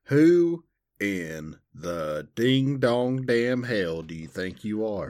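slow Southern drawl, loud at the start; hammed-up Southern telling-off; scolding Southern. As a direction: play mock outrage in Southern. Mock Southern scolding exchange.

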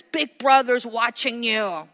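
slogan. Acted. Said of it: "slogan is".

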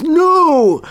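anguished cry. Acted. Potato-faced troll burning, yelling in anguish. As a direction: cry out like a man in agony. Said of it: "He goes".

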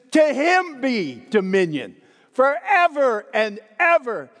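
joyful exclamation of praise to God; bursting into praise. Shown as a demonstration. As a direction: lift it up like praise breaking out. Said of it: "he just breaks out into praise".

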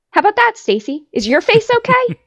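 a high, sing-song jab of a question; high mocking taunt. Mocking Stacy's question in a high taunting voice. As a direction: sneer it up high like a playground taunt.

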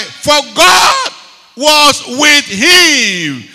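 loud, slow shouted answer; preaching shout. Real, not acted. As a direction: proclaim it with full force.

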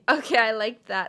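ordinary talk, nothing marked

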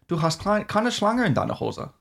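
high, lilting put-on German; strong German accent. Shown as a demonstration. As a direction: demonstrate an exaggerated German accent. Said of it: "like, a really strong accent".

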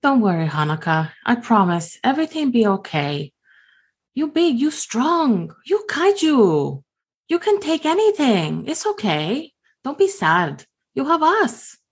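high, sweet consoling voice; childlike comforting. Acted. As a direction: soothe her like a small child would. Gim comforting Honoka again.

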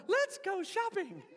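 high laughing doll voice; Barbie voice box. Retold or imitated. Swapped voice box in G I Joe, high doll voice. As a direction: chirp it like a doll through a laugh. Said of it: "and G I Joe goes".

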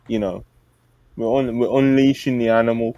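normal talking voice

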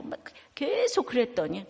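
usual speaking voice

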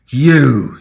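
a loud, high accusing bellow. A narrator's reading. Simeon rounding on Reva, bellowing. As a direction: fling the word at her like a blow. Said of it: "he bellowed at Reva".

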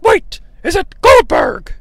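high, drawn-out mock surprise; startled announcers. Announcers mistake the entrance music, high mock astonishment. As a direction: exclaim like a baffled sports commentator. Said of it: "announcers start talking like".

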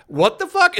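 high-pitched indignant yelp; outraged disbelief. Retold or imitated. Singer being hauled off to prison, outraged. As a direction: squawk it in mock outrage.

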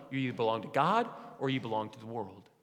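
ordinary delivery

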